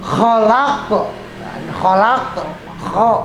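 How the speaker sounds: "Khalaq" with a rasped throat kh; throaty kho. Shown as a demonstration. Teacher modelling the letter, throaty kh in "Khalaq". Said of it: "cara pengucapannya itu sama di tenggorokan".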